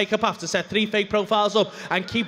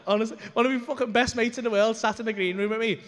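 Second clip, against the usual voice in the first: ordinary delivery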